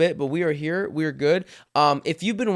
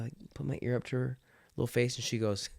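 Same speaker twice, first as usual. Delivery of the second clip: ordinary talk, nothing marked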